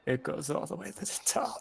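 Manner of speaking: quiet voice breaking into laughter; hushed laughing line. Acted. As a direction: whisper it through a laugh. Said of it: "Tedward just goes".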